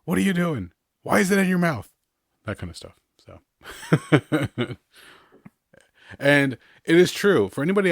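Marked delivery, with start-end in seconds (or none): 0.1-1.9 put-on louder, higher scolding at a toddler; exasperated parent. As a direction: bark at the kid grabbing things.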